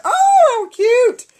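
very high, loud, drawn-out cooing; delighted gushing. Real, not acted. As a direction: gush loudly over something adorable. Delighted at next gift.

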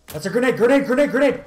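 high, loud panicked warning shout; grenade warning yell. Real, not acted. Warning teammate in game.

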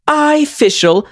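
high, proud royal declaration; high character voice. Acted. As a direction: announce yourself like a princess. Character voice lines.

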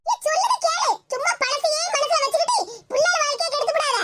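put-on old mother-in-law. The grandmother scolds her daughter-in-law for making the girl cry.

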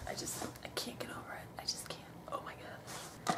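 fast, overwhelmed whisper; breathless whispered disbelief. Real, not acted. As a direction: whisper like you can't cope. Can't get over the box.

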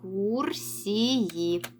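very slow, drawn-out Екскурсії; slow reading. Shown as a demonstration. Teacher modelling a word for pupils, very slow. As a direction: stretch out Екскурсії for young readers. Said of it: "Називаємо перше слово".

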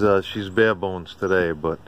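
usual speaking voice

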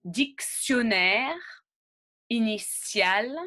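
"Dictionnaire" and "initial" said slowly with an S-like sound for the ti; slow classroom model of "Dictionnaire, initial"; slow model words. Shown as a demonstration. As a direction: pronounce each word slowly for repetition. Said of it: "écoutez et répétez".

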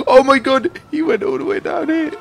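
sharp high gasp, then loud astonished cry; gasp of surprise. Real, not acted. Gamer gasping as a car falls, high and loud. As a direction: gasp and shout in amazement.

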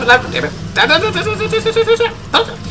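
usual speaking voice

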